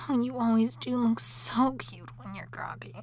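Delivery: ordinary delivery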